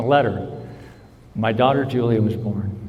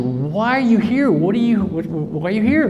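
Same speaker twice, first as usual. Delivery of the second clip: surprised question; 6 semitones higher than usual. Retold or imitated. Surprised to meet George again.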